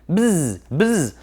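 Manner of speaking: put-on reading to a toddler, a light buzzing bee. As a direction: buzz it out lightly like a storybook bee. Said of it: "said bee".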